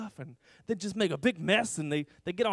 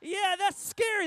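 high, drawn-out mock gasp of oh no; mock dismay; 11 semitones higher, much slower than usual. Shown as a demonstration. Teacher modelling a response for the children, high mock dismay. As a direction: show the kids a big theatrical oh no, pitched high. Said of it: "Everyone say".

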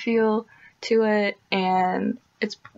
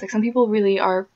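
ordinary talk

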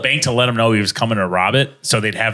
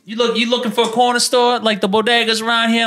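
much higher, put-on New York voice; New York accent; 11 semitones higher than usual. Italian local helping a tourist, high New York accent. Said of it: "That was a New York versus Brooklyn".

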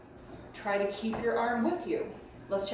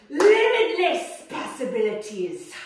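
grand announcement; 6 semitones higher, 8 dB louder, slower than usual. Acted. Playful grand pronouncement.

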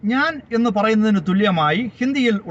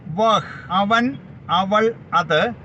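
each pronoun spaced out slowly; deliberate teacher's recap; slow, careful listing; much slower than usual. Shown as a demonstration. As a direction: spell out അവൻ, അവൾ, അത് slowly for learners. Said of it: "teacher recap of pronouns".

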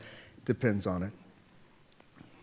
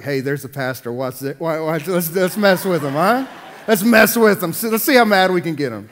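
playful scheming churchgoer voice, louder; mischievous congregant; 4 semitones higher, 9 dB louder than usual. Retold or imitated. Churchgoers plotting to rile the pastor, playful and louder. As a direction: goad each other to bait the pastor. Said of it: "I bet some of you are like".

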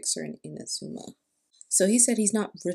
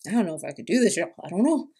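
nervous laughing; 7 dB louder, faster than usual. Real, not acted. Nervous about reading aloud.

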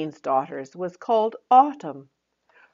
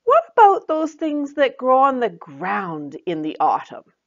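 higher, louder coaxing fairy friend; Joy hinting; 7 semitones higher, 4 dB louder than usual. Acted. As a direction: lead her to the answer. Joy, voiced in the story.

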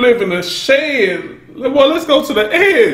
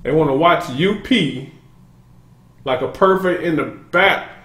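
ordinary talk

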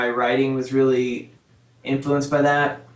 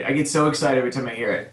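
ordinary talk, nothing marked

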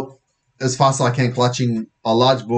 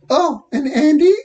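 put-on very high, slow girl's voice; slow, high-pitched afterthought; 4 dB louder than usual. Girl to arrested boy, high and slow. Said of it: "says Lisa softly".

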